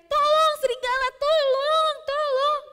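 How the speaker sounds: put-on panicked boy yelling for help; screaming for help. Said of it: "sambil teriak-teriak".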